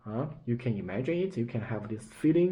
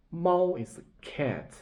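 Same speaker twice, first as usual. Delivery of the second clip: ordinary talk, nothing marked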